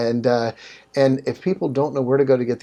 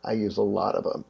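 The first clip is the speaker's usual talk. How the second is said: impish reply; 3 semitones lower, 7 dB quieter than usual. Retold or imitated. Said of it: "he had this impish look".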